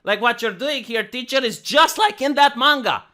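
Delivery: a mock student standing up to the teacher; indignant student. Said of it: "and be like".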